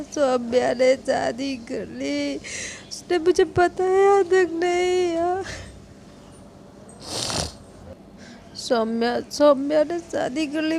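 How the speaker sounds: loud, high tipsy wail; drunk wailing. Acted. Drunk Yes wailing about Saumya.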